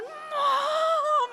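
high, very loud drawn-out cry; imitated daughter's howl; mock mortified wail. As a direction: wail for mum like an embarrassed child. Imitating two girls calling their mother.

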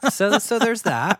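laughing. Acted. Laughing high wry tag line.

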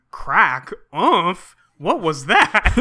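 loud, hammed-up bewildered outburst; Claudio reading sound effects. Host acting out Claudio's comic panel, hammed-up and baffled. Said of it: "the next panel is Claudio going".

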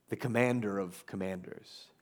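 low, hushed title; hushed, weighty "the commander of commanders"; quiet announcement. Real, not acted. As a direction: set the title down softly. Announcing the sermon point.

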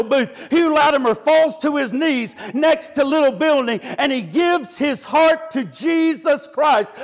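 emphatic storytelling building to a climax; full-force preaching. Real, not acted. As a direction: preach it with mounting drive.

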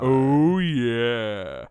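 hammed-up sultry voice; sultry oh yeah. Sultry voice bit.

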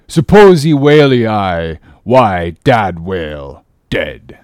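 deliberate foreign harpooner voice; broken-English harpooner. A narrator's reading. Queequeg shows his kill.

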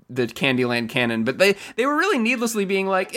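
usual speaking voice

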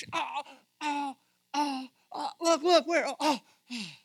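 high, yelped cries of pain; hammed-up frantic yelping, searching for help; mock snakebite panic. Bitten Israelite looking for the snake on the pole, panicked yelps.

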